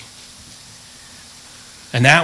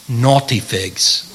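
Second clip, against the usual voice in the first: slow, lower, lightly British delivery; a touch of British on drawn-out words; British accent; 4 semitones lower, 3 dB quieter, much slower than usual. Acted. Preacher savours an old-fashioned Bible word, lightly British. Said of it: "very British".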